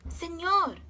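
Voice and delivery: high, squawked señor; squawking toucan. A narrator's reading. Toucan squawking a warning at the sleeping man. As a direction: squawk it like a bird. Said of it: "squawked the toucan".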